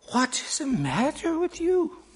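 high-pitched, sing-song questioning; startled woman. A narrator's reading. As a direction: ask it high, taken aback by the screaming. Woman answers screaming girl.

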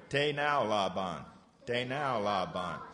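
Te nao la ban drawn out slowly; slow teaching demo. Shown as a demonstration. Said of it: "Can you say that?".

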